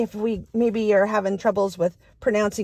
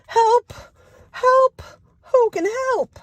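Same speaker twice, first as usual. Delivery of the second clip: high, loud cry of help; calling for help; 4 dB louder, slower than usual. Acted. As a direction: squeal for help like a stuck duck. Duck calls for help again.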